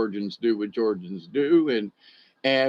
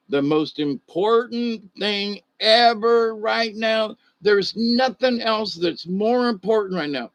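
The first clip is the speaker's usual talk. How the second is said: high, slow mock politician voice; mocking Nancy Pelosi; 9 semitones higher, 3 dB louder than usual. Mocking a politician's statement to viewers, high and slow. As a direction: drag it out high as a mock imitation. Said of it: "This is what she said".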